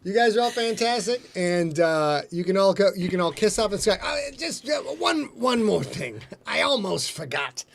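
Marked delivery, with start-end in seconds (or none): 4.0-7.6 a light hint of Columbo's hesitant one-more-thing; lightly voiced, halting detective afterthought. Host doing a quick Columbo for the audience. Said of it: "that's my Columbo".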